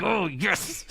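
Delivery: mock fan glee. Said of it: "It's like".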